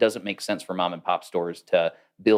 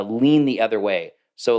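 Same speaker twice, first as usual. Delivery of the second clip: ordinary talk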